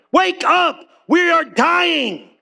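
high, slow desperate cry; begging Jesus to wake; panicked plea. Retold or imitated. Disciples waking Jesus in storm.

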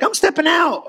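high, eager retold announcement; mock bold declaration again. Pastor retelling his own faith step, high eager mock announcement. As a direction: say it up high, proud and sure. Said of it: "I'm like".

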